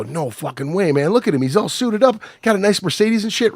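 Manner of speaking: mock disbelief climbing high in pitch; retold high-pitched incredulous objection; incredulous protest. Retelling his disbelieving protest to coworker.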